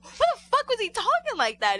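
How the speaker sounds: high, loud mock disbelief; retold exasperated yell; incredulous outburst. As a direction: blurt it out, baffled and loud. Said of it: "Shannon were like".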